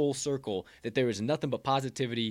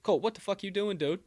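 loud, high call to snap himself out of it; self-scolding shout; 9 semitones higher, 8 dB louder than usual. Pretend. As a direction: yell at yourself to stop.

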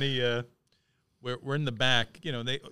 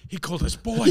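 higher, louder, delighted with a laugh; giddy fan; 9 semitones higher, 6 dB louder than usual. Retold or imitated. Friend quoting Roach's thrill, giddy and high. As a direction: gush it like a thrilled kid. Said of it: "Roach is like".

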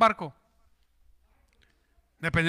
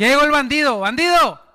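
louder, teasing call across the room; rowdy greeting; 3 semitones higher, 9 dB louder than usual. Acted. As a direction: greet a friend with a loud jokey insult. Friends greeting each other rudely. (saludo burlón en voz alta)

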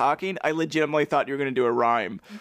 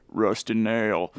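flat, lower automated PA announcement; automated announcer voice; 5 semitones lower than usual. Retold or imitated. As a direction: sound like a recorded system voice. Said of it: "this automated voice on a loud system that just says".

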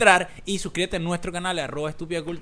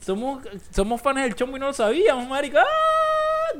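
high, louder excited outburst; excited shout; 10 semitones higher, 5 dB louder than usual. Real, not acted. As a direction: blurt it out giddily. Excited joke about fandom.

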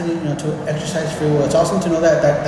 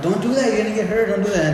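higher parental warning; scolding a nephew; 6 semitones higher than usual. Retold or imitated. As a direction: stop the kid before he gets hurt. Scolding nephew about outlets.

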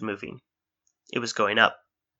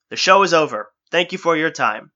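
higher, louder showman's farewell; carrying voice; 5 semitones higher, 6 dB louder than usual. Acted. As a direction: announce it to the silent crowd. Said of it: "His voice carried through the silent stadium".

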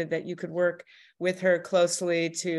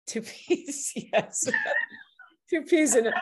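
giggling through the words, high and light; breaking into laughter while repeating it; amused host laughing at the mixed-up saying; 9 semitones higher than usual. Real, not acted.

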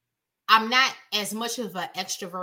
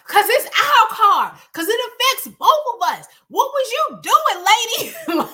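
angry retort; 10 semitones higher, 7 dB louder than usual. Retold or imitated. Wife snapping back at her calm husband, loud and angry. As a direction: fire it back heated and indignant. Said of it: "'cause I'm like".

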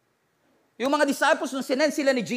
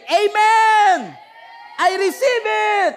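high, loud, slow shout of faith; shouted amen; 11 semitones higher, 7 dB louder, much slower than usual. Shown as a demonstration. Pastor demonstrating bold response to the congregation, loud shout. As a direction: shout it out with full conviction. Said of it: "and you say".

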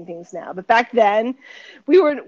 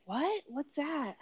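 softer, higher puzzled questions; baffled; 6 semitones higher, 8 dB quieter, slower than usual. Retold or imitated. Young actress puzzled by sprouted bread, baffled. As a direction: act lost, voice lifting in pitch. Baffled retold reply to agent.

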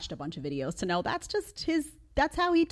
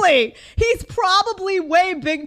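higher, louder sneering parrot; mocking whiny echo; 12 semitones higher, 9 dB louder than usual. Mocking her past self's hedging, sneering echo. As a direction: mimic the weak hedge with scorn. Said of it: "Do you hear the, the language?".

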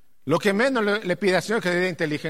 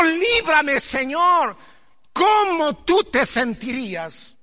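high, loud fervent preaching; full-force preaching; 9 semitones higher, 7 dB louder than usual. Acted. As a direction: preach it out to the congregation. (predicación a todo pulmón)

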